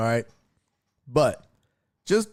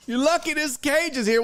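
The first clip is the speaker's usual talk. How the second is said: high mock taunt; tough guy taunt; 11 semitones higher than usual. Streamer repeats the cat's taunt at the dog, higher. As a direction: sneer from behind the cage. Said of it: "You be like".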